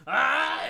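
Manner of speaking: loud, high drawn-out "ah"; imitated reaction cry. Player mimicking another's reaction to the bad roll, high yell. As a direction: yell out a long, piercing "ah". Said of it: "he goes".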